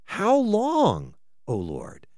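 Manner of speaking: rising cry that drops away to a hush; lament. Acted. Pastor voicing the psalmist's cry to God, falling away. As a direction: plead the question, let it fall.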